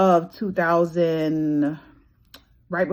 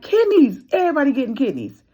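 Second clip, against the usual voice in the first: much higher and louder, a giddy cry of kidneys; excited exclaim; 9 semitones higher, 5 dB louder than usual. Retold or imitated. A patient joking about getting called again, high giddy exclaim. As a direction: throw it up high like you can't believe it. Mock excitement at another call.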